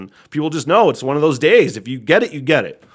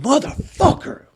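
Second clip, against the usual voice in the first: high-pitched mock scream; retold scream; 13 semitones higher than usual. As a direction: yell the curse high, replaying the outburst. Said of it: "and I just fucking screamed".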